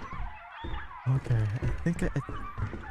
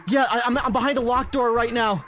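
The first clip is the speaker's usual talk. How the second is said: yelling across the room; 8 semitones higher, 4 dB louder than usual. Real, not acted. Calls out to teammates from behind a locked door.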